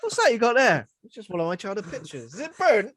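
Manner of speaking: high, loud put-on voice; high mock voice. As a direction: squeak it out playfully. Acting out a mock dialogue.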